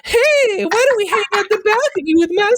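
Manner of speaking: high falsetto, quick chatter; very high breathy pop star voice. Acted. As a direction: rush it in a squeaky high voice. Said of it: "First impression".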